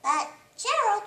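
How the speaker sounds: high, pleading little pig; pleading piglet. Acted. Piggy begs Gerald not to go, high and pleading. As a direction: reach out to her friend.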